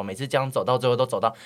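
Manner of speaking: ordinary delivery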